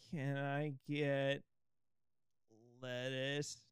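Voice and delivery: slow, loud customer request; loud slow order. Pretend. Customer ordering lettuce at the counter, slow and loud. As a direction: drag out the order loudly.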